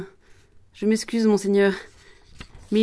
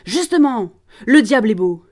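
furious proclamation; 3 semitones higher, 7 dB louder than usual. Acted. Promoteur beside himself, proclaiming loudly to Jeanne. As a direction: explode at her. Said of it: "proclame, dressé hors de lui".

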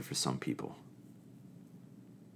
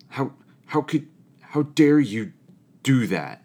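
mock furious, pitched up and loud; retold angry outburst; 8 semitones higher, 8 dB louder than usual. Campers scolding Uncle John, in a loud mock-outraged voice. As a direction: play the outraged camper, voice jumping up on dare.

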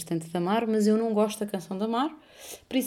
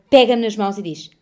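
usual speaking voice

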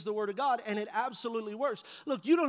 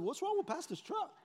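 mocking onlooker; 9 semitones higher, 4 dB quieter than usual. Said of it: "some Anchor Ben person".

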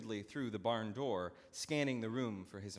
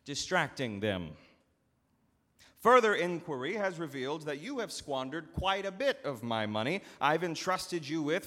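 louder, cold stern reproach; stern anger; 6 semitones higher, 9 dB louder than usual. Acted. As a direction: hold him to account, firmly. Master confronts steward.

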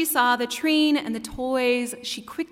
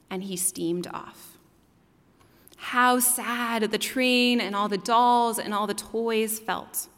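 ordinary talk, nothing marked

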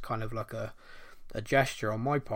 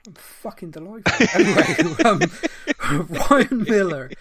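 high, loud, real laughter in the words; talking through a laughing fit; 8 semitones higher, 11 dB louder than usual. Real, not acted. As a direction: crack up while trying to carry on. Cracks up after being teased.

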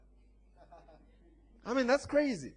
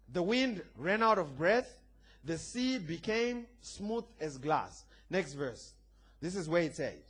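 ordinary talk

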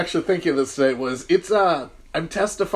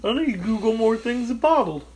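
mildly goofy dumb-guy voice, pitched up; Rocky's dumb voice; 6 semitones higher than usual. Retold or imitated. Teasing his co-host by imitating his dumb voice. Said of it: "What was that voice?".

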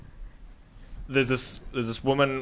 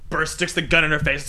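ordinary talk

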